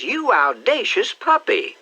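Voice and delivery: put-on high, slow, scandalized old aunt; outraged aunt after a stolen kiss; indignant aunt. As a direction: scold him slowly, shocked. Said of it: "wiped it off with the back of her hand".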